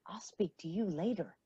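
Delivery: a hushed, voiceless aside; unvoiced stern whisper. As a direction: whisper the warning so only Amy hears. Mother privately warning daughter.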